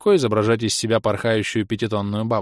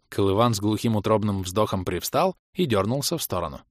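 ordinary delivery

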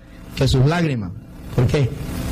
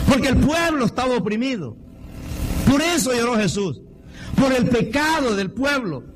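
higher, impassioned preaching; fervent preaching; 7 semitones higher than usual. Acted. Preacher telling the church why Jesus wept, fervent. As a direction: preach it up, raise the pitch.

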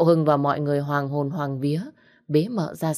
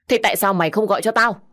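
fast, louder, angry snap; scolding shout; 4 semitones higher, 5 dB louder, faster than usual. Acted. Master scolds the maid for not calling him, angry shout. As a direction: bark at the maid. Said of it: "nên quát con Liễu".

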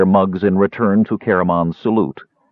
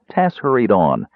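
plain reading voice

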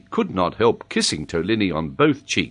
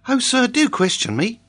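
put-on high, eager child voice; pleading schoolboy. A narrator's reading. Boy begs the professor to examine him, high eager plea. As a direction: beg like a keen pupil.

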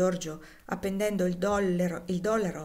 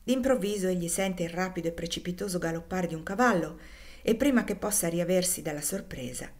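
usual speaking voice

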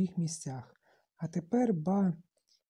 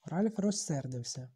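ordinary delivery

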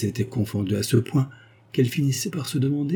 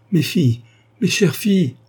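higher, louder exclamation; overjoyed cry; 8 semitones higher, 5 dB louder than usual. A narrator's reading. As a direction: cry out to your daughters, high and loud. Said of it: "s'écria-t-elle".

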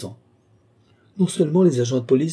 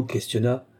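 usual speaking voice

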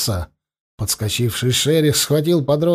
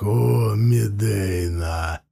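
werewolf; 2 semitones lower, much slower than usual. Acted. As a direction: growl it like a wolf lord. Werewolf Sherikh calls his pack.

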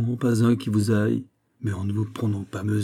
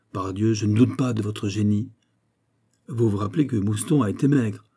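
ordinary delivery

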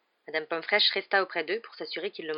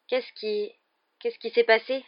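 higher-pitched muddled waking voice; groggy grumble; 6 semitones higher, 5 dB louder, slower than usual. A narrator's reading. Ron waking up confused in bed, grumbling. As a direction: stumble into the question, just woken. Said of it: "grogna-t-il".

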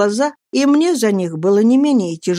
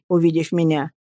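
usual speaking voice

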